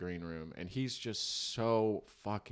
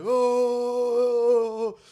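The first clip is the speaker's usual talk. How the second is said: crowd oh; 14 semitones higher, 13 dB louder than usual. Retold or imitated. Irish crowd reacting to him.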